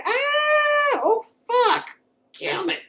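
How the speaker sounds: very high hammed-up yelps of pain; mock pained cries. Stabbed alien woman cries out, very high mock pain. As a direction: overplay being stabbed, squealing high. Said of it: "She's like".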